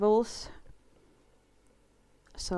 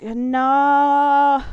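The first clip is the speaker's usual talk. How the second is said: long, loud, higher "no"; mock-dismayed cry; 6 semitones higher, 10 dB louder than usual. Caught saying bloody unironically, mock-dismayed cry. Horrified at own British word.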